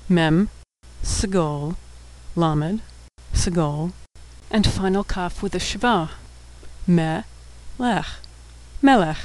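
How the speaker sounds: letter by letter, then syllable by syllable; sounding out. Shown as a demonstration. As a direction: spell it out with pauses, then say the whole word. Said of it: "we'll be sounding out all of the words".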